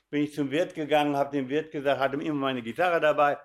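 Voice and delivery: normal talking voice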